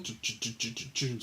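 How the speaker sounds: soft mouth percussion ch sounds; mouth drum beat. Pretend. Host beatboxing a quiet intro beat. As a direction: tap out a beat with your mouth.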